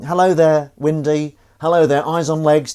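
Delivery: loud, high hello to each viewer; loud, bright-pitched hello there to chat names; cheery greeting. Real, not acted.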